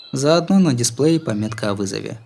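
usual speaking voice

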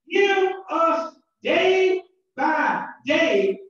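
ordinary delivery

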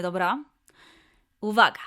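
ordinary delivery

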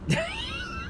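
squeaky high chuckle; high laugh at own joke. Real, not acted. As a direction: crack up at your own joke. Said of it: "I laugh at my own jokes".

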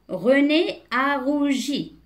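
deliberate, word-by-word reading; slow model reading. Shown as a demonstration. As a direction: read it out slowly for beginning readers. Slow model reading, later explained.

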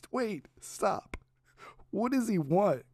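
high, laughing disbelief. Real, not acted. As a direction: crack up and ask again. Reacting to absurd news.

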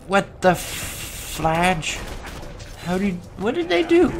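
high startled disbelief; surprised. Real, not acted. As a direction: caught off guard, voice jumping up. Surprised at camouflage.

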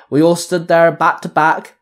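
plain reading voice

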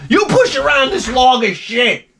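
high exasperated punchline; a bitter self-mocking outburst; mock outraged. Real, not acted. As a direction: spit out the self-insult.

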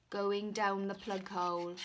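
ordinary delivery

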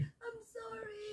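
slow, soft, drawn-out sorry; sarcastic apology. Real, not acted. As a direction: stretch out a mock-contrite sorry. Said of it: "that's sarcastic".

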